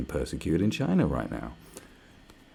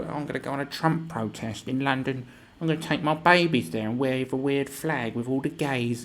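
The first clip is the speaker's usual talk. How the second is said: higher, smug mocking voice; mocking a protester; 5 semitones higher than usual. Mocking London protesters in a smug voice. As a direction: sneer it like a self-righteous protester. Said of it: "These people who go".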